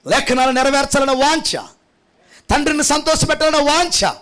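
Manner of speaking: rising, high, loud preaching build; full-force preaching. Acted. Pastor rousing the congregation, building to a shouting preach.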